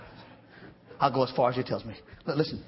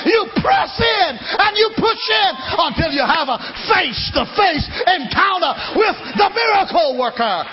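higher, louder shouted preaching climax; climactic preaching; 7 semitones higher, 6 dB louder than usual. Acted. Preacher urging congregation to press in, loud high shouted climax. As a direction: hit the peak and hold it.

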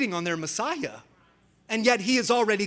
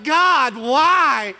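mock lament; 5 semitones higher, 7 dB louder than usual. Kid crying out to God.

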